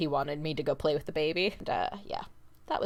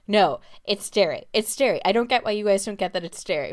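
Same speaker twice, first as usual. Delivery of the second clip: louder, hammed-up stubborn brother-in-law; mock him digging his heels in, loud and fast at the end; stubborn insisting; 3 semitones higher, 6 dB louder than usual. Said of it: "kept doubling down on it".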